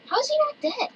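high, loud outraged question; incredulous. Real, not acted. Player cannot believe opponent survived, incredulous. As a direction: exclaim in disbelief, pitch shooting up.

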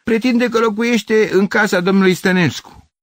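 high, loud call to the neighbours; strained, indignant yell; scornful shout. Acted. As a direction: report the stranger's claim at full voice. Neighbour shouts complaint to others.

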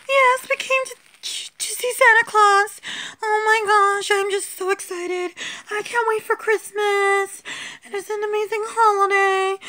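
high squeaky doll voice, thrilled; giddy excitement. Acted. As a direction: gush like a child before Christmas. Doll gushing about Christmas.

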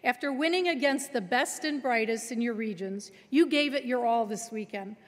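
normal talking voice

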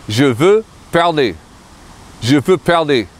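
je veux parler, stress on veux, French vowels; French pronunciation. Shown as a demonstration. Teacher models je veux parler, careful French. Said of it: "I want to speak".

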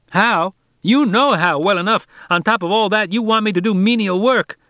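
put-on a proud boy scolding a grown man; fierce harangue. A narrator's reading. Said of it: "Harvey harangued fiercely".

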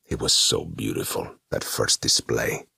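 a demon fondly recalls horror; wistful demon. A narrator's reading. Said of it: "glancing wistfully".

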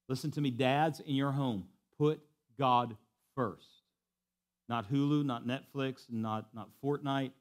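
ordinary delivery

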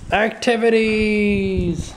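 high, drawn-out gleeful cry; put-on high doggy excitement; excited dog voice. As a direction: stretch the word out high and slow. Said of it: "Bandit's like".

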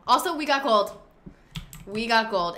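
loud, bright cheer; triumphant. Real, not acted. Host cheers that the party earned gold, loud triumph. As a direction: crow about the win.

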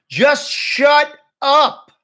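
high, loud, each word drawn out; fed-up outburst. A narrator's reading. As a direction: lose patience, spacing out every word. Jack snaps at Julian, fed up.

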